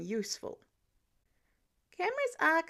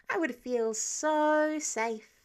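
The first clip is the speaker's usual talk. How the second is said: higher, slow, overdone sincerity; would-be genuine; 5 semitones higher, 4 dB louder, much slower than usual. A narrator's reading. Peter feigning relief about Spider-Man, overly genuine. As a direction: stretch out "so" in strained earnestness. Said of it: "Peter tried to sound genuine".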